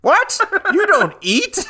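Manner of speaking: high squeaky disbelief; mock baffled squeak. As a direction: shoot way up in mock shock.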